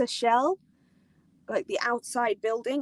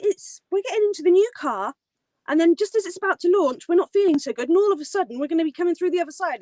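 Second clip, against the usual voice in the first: ordinary talk, nothing marked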